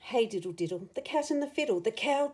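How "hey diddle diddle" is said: ordinary delivery, nothing marked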